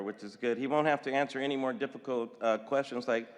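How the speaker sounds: usual speaking voice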